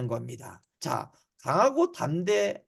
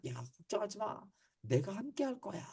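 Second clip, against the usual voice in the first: gentle reassurance; 13 dB quieter than usual. Acted. God reassuring frightened Israelites.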